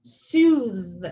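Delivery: emphatic voiced TH on Soothe; voiced TH demo. Shown as a demonstration. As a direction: Soothe with a strong buzzing TH. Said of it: "there's an emphasis".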